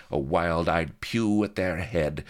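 ordinary delivery, nothing marked

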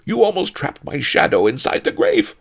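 very high, loud panicky complaint; frightened yelp. A narrator's reading. Grave robber whose shadow was nearly trapped in grave, frightened yelp. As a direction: yelp it out in alarm. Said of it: "leaping back in fear".

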